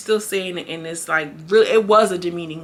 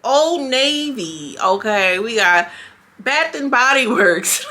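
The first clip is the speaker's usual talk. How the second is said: slow, drawn-out, higher proud list; listing store cards; 4 semitones higher, 3 dB louder, slower than usual. Retold or imitated. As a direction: savor each store name slowly. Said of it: "She's like".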